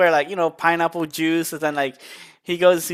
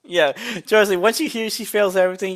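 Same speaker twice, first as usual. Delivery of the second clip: normal talking voice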